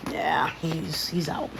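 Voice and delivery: whispered so the dog stays asleep. Real, not acted. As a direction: breathe it out without voice.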